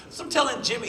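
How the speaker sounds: ordinary talk, nothing marked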